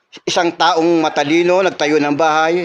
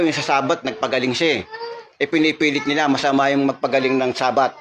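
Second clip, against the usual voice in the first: ordinary talk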